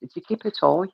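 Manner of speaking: retold in a lilting Brummie voice; Brummie accent. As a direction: Brummie "kipper tie", tie as toy. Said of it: "Is that how the accent makes it sound?".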